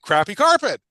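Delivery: loud, emphatic punchline. Real, not acted. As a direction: land the saying hard. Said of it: "I got a saying for you".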